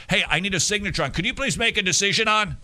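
high, rapid-fire plea; hurried request. Acted. Adults rushing to a six-year-old for a signature, hurried request.

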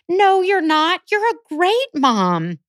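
high, slow gushing reassurance; reassuring friend. Retold or imitated. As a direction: play the sweet friend insisting kindly. Friend reassures her at church.

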